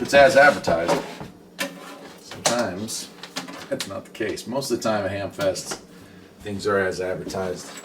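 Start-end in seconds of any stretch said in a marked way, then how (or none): none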